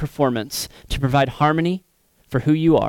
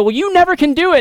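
high mock scold; 6 dB louder, faster than usual. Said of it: "And they go".

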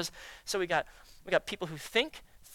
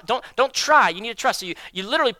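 ordinary talk, nothing marked

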